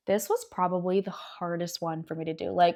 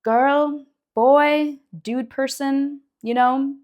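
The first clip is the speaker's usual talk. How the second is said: louder and higher, stretching out person; exasperated call-out; 6 semitones higher, 8 dB louder, much slower than usual. Retold or imitated. Vlogger calling out a hectic friend, louder and higher. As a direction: call out to them, half teasing, to slow down.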